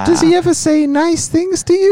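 put-on loud falsetto girlfriend question; high-pitched girlfriend. Playing own girlfriend quizzing the other girlfriend, loud falsetto. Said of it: "My girlfriend's got quite a high-pitched voice".

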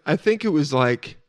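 ordinary talk, nothing marked

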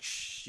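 soft, voiceless shush; a quiet "shh" to settle her; imitated shushing. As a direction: hush someone gently. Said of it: "like, calm her down".

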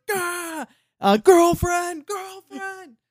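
put-on high panicked squeak on girlfriend, drawn out; panicked freak-out squeal. Shy snail panics near a girl, high squealing freak-out. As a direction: squeal it like a terrified snail losing it. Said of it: "His freak out scream".

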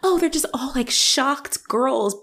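mock shocked girl. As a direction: ham up a scandalized girl clutching her pearls. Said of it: "they didn't do the thing where it's like".

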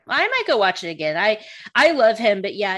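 normal talking voice

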